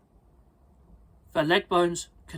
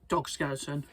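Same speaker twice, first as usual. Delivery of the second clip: ordinary delivery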